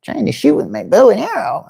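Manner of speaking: high, rough attempt at a puppet voice; Gonzo. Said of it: "can't really do a good Gonzo impersonation".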